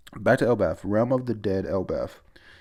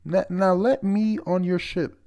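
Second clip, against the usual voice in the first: high pleading voice; 10 semitones higher, 4 dB louder than usual. Retold or imitated.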